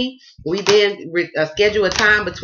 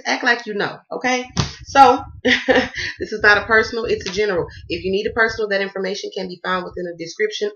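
ordinary delivery

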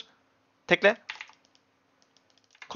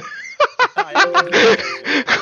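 loud burst of laughter; 12 semitones higher, 4 dB louder than usual. Real, not acted. Laughs at teammate's confusion.

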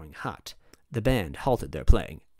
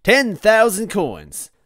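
slow, high, loud announcer call; big drawn-out fanfare; grand announcement; 11 semitones higher, 10 dB louder, much slower than usual. A narrator's reading. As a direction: proclaim the jackpot, stretching every word. Grand prize option announced.

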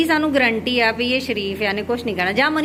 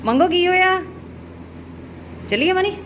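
bright calling; 9 semitones higher, 3 dB louder than usual. Real, not acted. High call to cow and sister.

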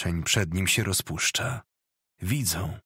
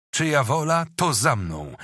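rallying call; 5 semitones higher, 4 dB louder than usual. A narrator's reading. Leader rallies men to charge.

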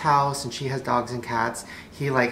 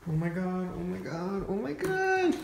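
breathy squeals that climb sharply higher each time; rising excitement; 8 semitones higher, 7 dB quieter than usual. Real, not acted. To the cat in the box, excitement climbing into a high squeal. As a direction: build from soft gasps to a high squeal. First glimpse of the new cat.

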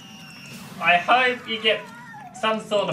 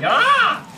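sharp high yelp, louder; short startled cry; 6 dB louder than usual. Real, not acted. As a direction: jump with a yelp of fright. Sudden scare in game.

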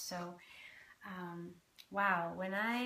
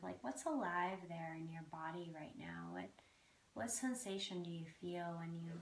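guided meditation. Acted. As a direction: ease them gently into the breath.

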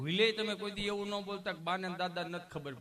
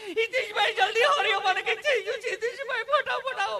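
much higher, much louder hammed-up panic screams; panicked shrieking mother; 10 dB louder than usual. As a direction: shriek for help like the child is dying. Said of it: "રાડે રાડ". (ગભરાટમાં ખૂબ ઊંચી, મોટી રાડો)

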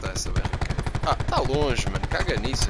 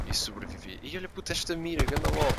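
ordinary delivery

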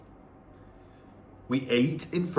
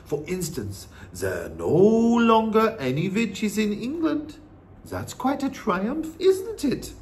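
put-on old-woman voice lilting upward; pleased, coaxing grandmother; wise Norwegian grandmother; 7 semitones higher than usual. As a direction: point out the triumph proudly. Grandmother reassures boy.

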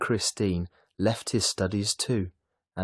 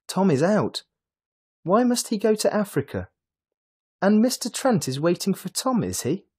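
much higher, worried questions; anxious; 12 semitones higher, 5 dB louder than usual. A narrator's reading. Mrs Dale fretting about Tom going to Africa, anxious. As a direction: fret over the sudden news. Worried wife hearing sudden news.

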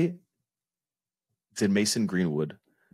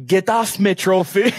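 a mock Jamaican accent that slides toward Irish, slow and high; botched Jamaican accent, drawn out; slow put-on Jamaican accent that comes out Irish; attempted Jamaican accent; 10 semitones higher, 4 dB louder, slower than usual. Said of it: "why did you go full leprechaun".

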